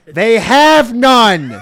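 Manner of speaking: slow, loud laughing punchline. Real, not acted. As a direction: laugh it out slowly.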